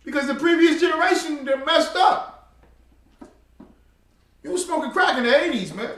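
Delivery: loud, high, driving sermon voice; fiery preaching. Acted. Preacher scolding the old generation, loud and fired up. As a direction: hammer the congregation about bad elders.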